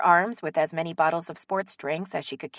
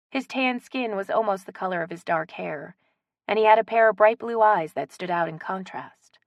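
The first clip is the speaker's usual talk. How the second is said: ordinary delivery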